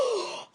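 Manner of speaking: sharp gasp of delight; gasp, thrilled by the news; quick breathless delighted gasp. Real, not acted. Hearing of new book.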